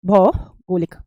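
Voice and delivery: भौगोलिक split as "BHAU... golik"; low, pause after भौ in भौगोलिक; slow model pronunciation. Shown as a demonstration. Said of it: "भौगोलिक होता है". (भौगोलिक को तोड़कर धीरे बोलना)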